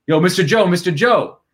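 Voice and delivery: mock call to get someone's attention. Player hailing the reporter on a call, calling out.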